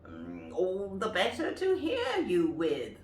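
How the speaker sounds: smooth cunning wolf; wolf in granny's bed answering; sly wolf. Acted. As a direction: purr the answer slyly. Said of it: "said the wolf".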